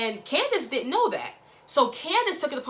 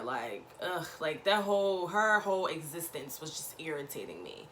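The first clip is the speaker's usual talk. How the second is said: normal talking voice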